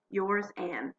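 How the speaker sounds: ordinary delivery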